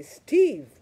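loud, high excited call of "Steve". Retold or imitated. Spotting an old coworker on the trail, a loud happy call. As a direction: call out his name, delighted. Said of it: "I say".

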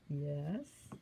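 ordinary delivery

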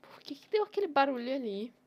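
loud, high frightened question; jumpy, spooked by a noise; scared horror game streamer. Real, not acted. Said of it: "eu fiquei com medo". (streamer com medo de um barulho)

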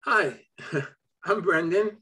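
usual speaking voice

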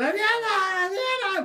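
very high, drawn-out call of a name; mock yell. Storyteller nearly yelling to an actress they love, high mock yell. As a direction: hail a beloved star from afar, stretching the name. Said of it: "ya le iba a gritar".

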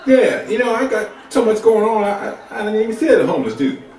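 high, fast, breezy fake phone chatter; fake phone call. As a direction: brush him off with a busy phone act. Pretending to be on phone.

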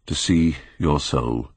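slow, grave and sorrowful; a hushed, sorrowful repeat. A narrator's reading. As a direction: say it slowly, weighed down with grief. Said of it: "gravely and with deep-toned sorrow in his voice".

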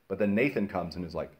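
usual speaking voice

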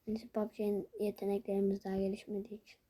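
unvoiced whispered aside. Real, not acted. Gamer explaining to viewers in a whisper. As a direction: drop to a whisper.

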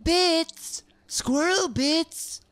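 high, loud and slow squeal of delight; excited. Real, not acted. Looting squirrel bits, a slow excited squeal. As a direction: draw out the joy of the find. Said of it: "I'm excited".

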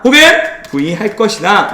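usual speaking voice

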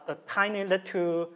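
ordinary talk

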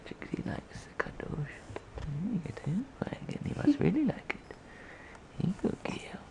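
soft whispered cooing; whispering. Real, not acted. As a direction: whisper so the dog stays calm. Whispering while dog cuddles.

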